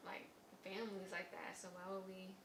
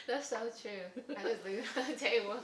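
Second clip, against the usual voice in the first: laughing; 4 semitones higher, 11 dB louder than usual. Real, not acted. Laughing at being called out.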